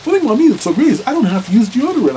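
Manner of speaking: high, loud proud boast; smug friend. Retold or imitated. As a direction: brag it as a great discovery. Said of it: "And he once told me".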